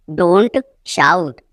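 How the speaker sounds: teacher separating "Don't" from "shout"; broken-up repeat. Shown as a demonstration. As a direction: pause after "Don't".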